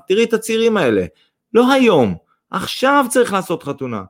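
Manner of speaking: high, loud insistence; emphatic exclamation. A narrator's reading. Father demands an immediate wedding, emphatic. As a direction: exclaim it, punching each phrase.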